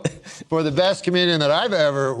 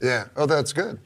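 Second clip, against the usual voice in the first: ordinary delivery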